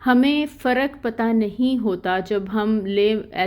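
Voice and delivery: ordinary talk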